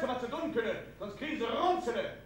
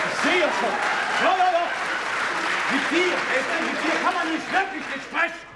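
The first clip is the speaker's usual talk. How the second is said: louder, a bit higher, fed up; exasperated shouting; 4 semitones higher, 6 dB louder than usual. Acted. Husband shouting at his wife Esther, exasperated yell. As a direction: throw up your hands and shout. Husband yells back in quarrel.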